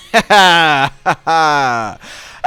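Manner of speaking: loud, slow two-burst laugh; hearty laugh. Real, not acted. Host joking about getting to the game sooner, loud drawn-out laugh. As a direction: laugh out loud, falling in pitch. Laughs after mentioning Tears of the Kingdom.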